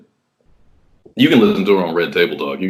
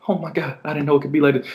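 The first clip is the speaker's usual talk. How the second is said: a high, breathy-sounding hushed awe, softer; mock amazement; 7 semitones higher, 4 dB quieter, faster than usual. Man imitates the boyfriend's high, hushed amazement. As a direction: gasp it out high in disbelief.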